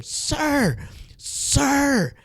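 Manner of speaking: high, urgent call of sir; calling after him urgently, high and pressing. Retold or imitated. Hotel guy calls urgently after a departing guest, high urgent call. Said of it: "like, with urgency".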